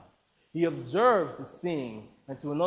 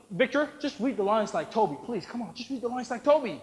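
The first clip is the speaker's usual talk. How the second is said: higher, hushed urging; anxious pleading; 6 semitones higher than usual. Acted. Colleague pleads with him.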